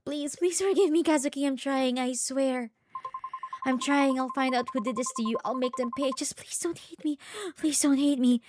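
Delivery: high, tearful begging; desperate pleading. Acted. Begging Kazuki for forgiveness, desperate pleading. Acted plea to dead partner.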